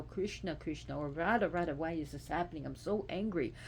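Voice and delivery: ordinary talk, nothing marked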